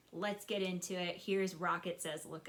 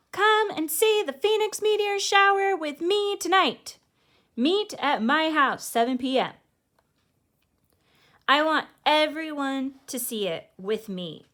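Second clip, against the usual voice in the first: much higher, louder eager call; excited invitation; 9 semitones higher, 13 dB louder than usual. Acted. Rocket inviting everyone to the meteor shower, excited calling out. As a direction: call out to the whole street with excitement.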